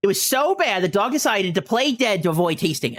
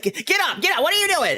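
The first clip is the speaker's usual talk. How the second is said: much higher, frantic mom shouting at the dog; mock yell at dog; 12 semitones higher than usual. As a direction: imitate mom yelling the dog up. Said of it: "she's, like, yelling at the dog".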